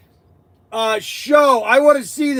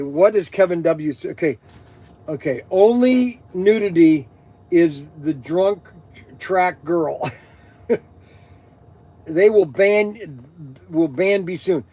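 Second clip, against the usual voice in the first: normal talking voice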